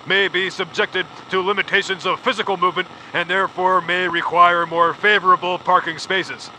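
ordinary delivery

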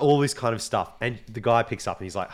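ordinary talk, nothing marked

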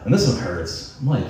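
normal talking voice

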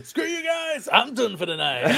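high-pitched mock outburst; angry shouting. Host re-enacting quitting over the reveal, angry shouting. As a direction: storm off with a high, loud parting yell. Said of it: "I was shouting at them".

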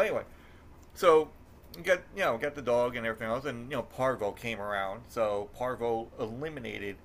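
normal talking voice

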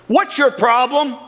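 accusatory demand. Shown as a demonstration. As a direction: jab the question at them like picking a fight. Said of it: "It goes from being an accusatory tone".

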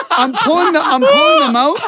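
high-pitched laughing squeal. Real, not acted. Telling friends the gross story, cracking up high and loud.

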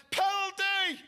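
leaping-up yell of penalty; full-throated stadium shout. Retold or imitated. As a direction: belt it out like a fan on his feet. Stands up shouting at a foul.

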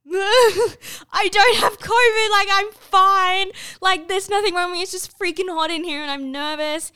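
high, loud, drawn-out pleading, easing off towards the end; mock distressed protest. Learner driver tells tester she isn't sick, mock distressed protest. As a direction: plead your case in hammed-up despair. Acts out protest at being refused.

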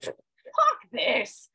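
mock defiant outburst; defiant curse. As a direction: spit it out in defiance. Retold defiant decision.

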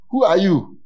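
demon's challenge. Retold or imitated. Demons challenging the false exorcist.

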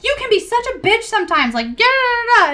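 high, mocking sneer at her; coworkers' snide jab, done in a high voice; mocking coworkers. Coworkers snapping at the bartender, high and mocking. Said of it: "They were like".

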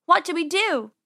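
high, loud and desperate; alarmed high-pitched question; panicked yell. Acted. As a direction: cry out for help when trapped. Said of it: "she yelled".

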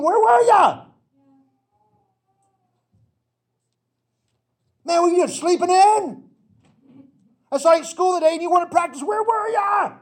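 loud, high, ribbing shout at a teammate; teammates calling him out. Retold or imitated. Teammates loudly calling out a guy who skipped practice. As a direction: yell it like a buddy who caught you skipping. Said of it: "he heard from guys on the team".

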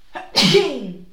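loud real sneeze; sudden sneeze; sneezing. Real, not acted. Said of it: "Ai, eu vou espirrar".

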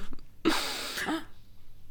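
a sudden, airy gasp; sharp gasp. Retold or imitated. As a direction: catch your breath in shock at the memory. Remembering waters breaking at home.

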